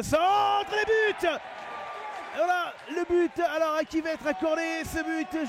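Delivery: excited sports commentary. Real, not acted. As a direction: belt out the goal. Goal call in live commentary.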